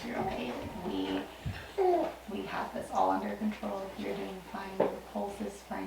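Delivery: ordinary delivery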